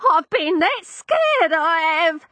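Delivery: put-on high, slow, quavering old voice; frightened old woman crying. Landlady telling her lodger how scared she was. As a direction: sob out the fright.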